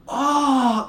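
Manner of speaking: loud high cry of shock and relief; high, hammed-up yell; overjoyed and enraged scream, imitated. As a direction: mimic a friend's outburst on learning the truth. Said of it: "didn't know whether to be overjoyed".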